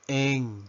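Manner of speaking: letter N pronounced "en"; English letter N. Shown as a demonstration. Teacher modelling the English alphabet, N as "en". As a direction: say N as one-syllable "en". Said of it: "La letra N".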